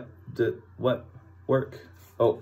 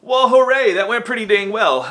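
higher and louder cheer; happy hooray; 8 semitones higher, 6 dB louder than usual. Real, not acted. Vlogger cheers after the phone call went well.

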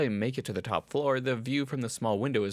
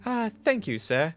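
meek servant thanks; 8 semitones higher than usual. Acted. Marion thanks Jack.

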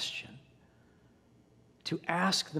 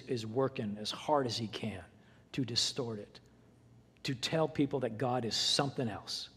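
hushed whispered warning; preacher warning the church, whispered; 4 semitones lower, 6 dB quieter than usual. Real, not acted. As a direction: lean in and whisper it. Warning about the enemy.

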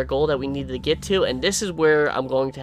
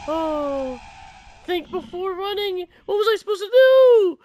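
panicked shriek; 3 dB louder than usual. Real, not acted. Panic, crying out.

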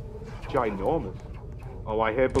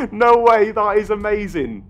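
laughing; 4 semitones higher, 7 dB louder, slower than usual. Real, not acted. Laughs at ferris wheel escape.

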